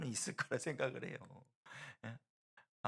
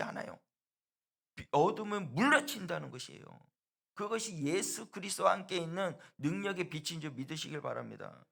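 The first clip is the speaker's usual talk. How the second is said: much higher, loud cry at the peak; fiery preaching; 4 semitones higher, 7 dB louder than usual. Acted. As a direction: shout down the darkness from the pulpit. Preacher's peak against darkness.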